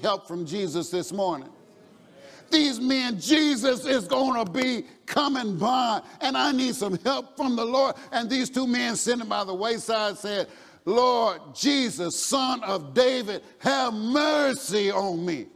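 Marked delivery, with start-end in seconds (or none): 2.5-10.5 raised, higher preaching voice. Real, not acted. Preacher tells of men needing help from the Lord, preaching. 10.9-15.5 slow, drawn-out cry for mercy; loud crying out. Acted. Blind men by the wayside beg Jesus, crying out loud. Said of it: "They kinda got loud".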